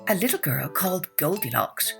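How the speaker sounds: ordinary delivery, nothing marked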